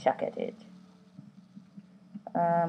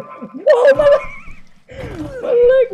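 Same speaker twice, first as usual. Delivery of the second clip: sudden high-pitched whoa; startled high yelp; 8 dB louder than usual. Real, not acted. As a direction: yelp as the leg snaps. Startled at game crash.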